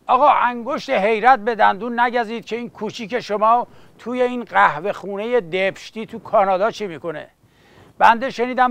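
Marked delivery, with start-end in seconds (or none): none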